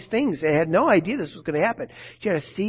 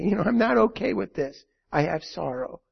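normal talking voice

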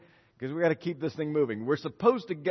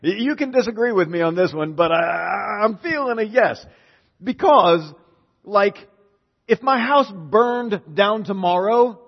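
ordinary delivery

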